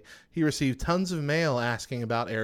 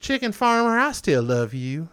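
mock lovesick declaration; 7 semitones higher, 5 dB louder than usual. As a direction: croon it like a lovesick suitor. Rereads the rock message, heightened.

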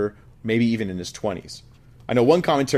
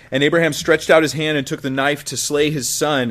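raised, solemn Bible reading; scripture reading; 3 semitones higher, 4 dB louder than usual. Acted. Preacher reading Genesis to the congregation, solemn. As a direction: read it as the drama builds.

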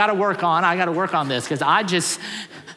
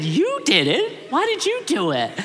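surprised; 8 semitones higher than usual. Retold or imitated. Husband astonished at wife's confession.

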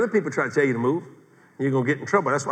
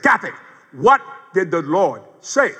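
insistent questioning; 3 semitones higher, 4 dB louder than usual. Retold or imitated. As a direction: drag out each word to press her. Presses wife until she gets mad.

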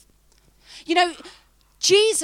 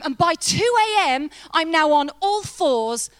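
normal talking voice

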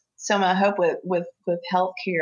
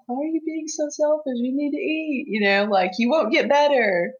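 scolding sister; 8 semitones higher than usual. Retold or imitated. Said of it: "I was just like".

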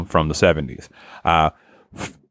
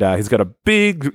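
ordinary delivery

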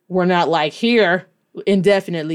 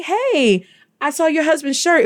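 higher-pitched friendly hello; retold friendly greeting; 7 semitones higher than usual. Fan telling the wife about her husband's shirt, high friendly voice. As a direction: re-enact a chirpy approach to a stranger. Acting out own friendly reply.

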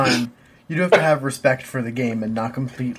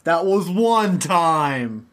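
slow insistence; 5 semitones higher, much slower than usual. Real, not acted.

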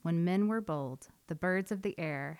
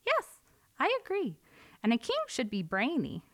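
little wren; 8 semitones higher than usual. A narrator's reading. Wren insists.

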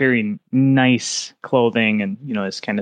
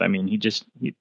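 usual speaking voice

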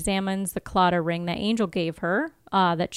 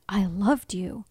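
half-whispered, wounded confession; hushed acted line; slower than usual. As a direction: whisper it like a betrayed lover in a recap. Reads Angel's wounded line.

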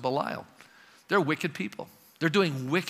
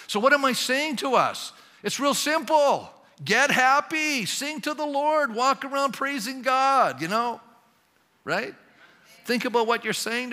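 much higher, louder and slow, sermon climax; preaching at full force; 9 semitones higher, 5 dB louder than usual. Acted. Pastor urging the congregation to get happy, full-force preaching. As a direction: drive it home like a revival preacher.

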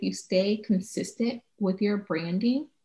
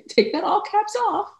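playful demand; 13 semitones higher than usual. Real, not acted. Telling texters to drop all caps, playful high demand. Mock demand after laughing.

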